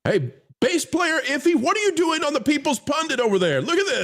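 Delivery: high-pitched mock scolding; mock call-out. Host calling out bass player in the rival chat, mock scolding.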